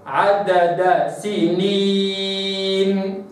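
عدد سنين very slow, dal held clean; Quranic recitation. Shown as a demonstration. Teacher models عدد سنين slowly for students. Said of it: "هكذا".

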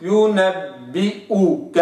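slow ينبئك with ب kasra kept; slow recitation; full kasra. Shown as a demonstration. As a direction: complete the i in ينبئك. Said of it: "لابد أن نستكمل".

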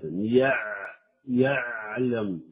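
يعلم with the ain pressed hard, tense and dragged out slowly; يعلم with an overly hard, tense ain; too harsh ain. Shown as a demonstration. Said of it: "فيجعلها شديدة قاسية".

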